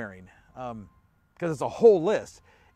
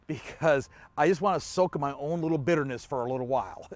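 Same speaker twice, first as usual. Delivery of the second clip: mock annoyed retort; 2 semitones lower than usual. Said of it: "look at Paul and go".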